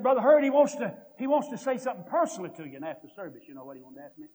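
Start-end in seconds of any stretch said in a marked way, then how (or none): 0.0-2.8 excited calling out. Retold or imitated. Grandpa eagerly relaying a request.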